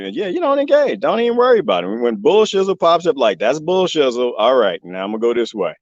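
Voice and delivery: usual speaking voice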